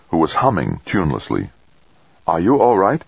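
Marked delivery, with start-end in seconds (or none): 2.3-3.0 nervous. A narrator's reading. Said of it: "Fuchida asked nervously".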